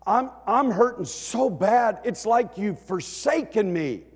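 acted-out wounded prayer; anguished plea. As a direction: pour out the hurt to God loudly. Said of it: "He's expressing his feelings".

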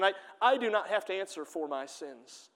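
ordinary delivery

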